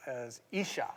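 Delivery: Isha said EE-shah, stress on the first syllable; Hebrew pronunciation. Shown as a demonstration. Isha in Hebrew, ee-shah, pastor teaching the word. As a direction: say Isha as ee-SHA with a soft sh. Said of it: "That's how you say it".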